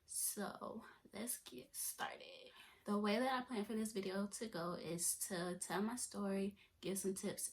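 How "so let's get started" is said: slow, hushed whisper. Real, not acted. Vlogger whispering to viewers to begin. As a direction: draw it out in a hush.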